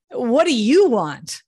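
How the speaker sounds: YOU loud and stretched, slow question; stress on YOU. Shown as a demonstration. Coach showing how emphasis changes the question, stress on YOU. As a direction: punch "you", turning the question on the listener. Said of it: "depending also on where you put the emphasis".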